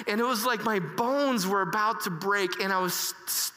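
usual speaking voice

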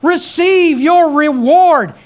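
high, loud, ringing preacher cadence; full-force preaching. Acted. Preacher rousing the congregation about God's provision, full-force preaching. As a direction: preach it out to the whole church.

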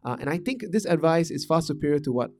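usual speaking voice

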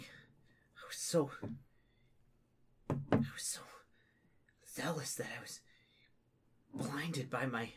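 halting, whispery remorse; hushed, broken acted regret; weeping confession.

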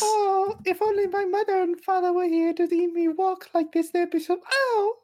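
put-on high child voice. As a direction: play a wide-eyed little orphan boy. Orphan child character speaks.

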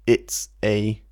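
usual speaking voice

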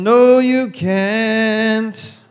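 very slow, high, loud mock denial; laughing slow comeback; drawn-out retort. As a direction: stretch out the "no" teasingly. Reply in retold banter.

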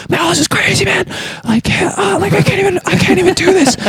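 very high, mock-panicked whine; freaking out. Imitating the dude phoning Miyagi, hammed-up freak-out. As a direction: imitate a man losing his nerve. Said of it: "freaking out".

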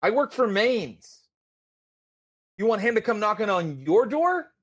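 high, taunting thug voice pushed up in pitch; menacing armed dealer. Acted. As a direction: threaten him with a sneering laugh in the voice. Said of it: "he's like".

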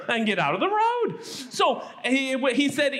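ordinary delivery

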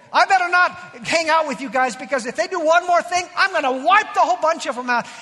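loud, high, heated threat; angry God. Retold or imitated. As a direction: rant at full force as a fed-up God. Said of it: "God really meant it here".

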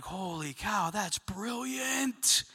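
soft, drawn-out amazement; hushed awe. Acted. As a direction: let the realization dawn slowly, under your breath. Acting viewers' dawning awe.

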